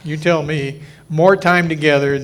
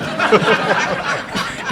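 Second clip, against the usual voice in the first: breathy burst of laughter; real laugh; 6 semitones higher than usual. Real, not acted. Speaker cracking up after telling the crowd the punchline, laughing. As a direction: laugh at your own story.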